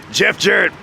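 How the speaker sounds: usual speaking voice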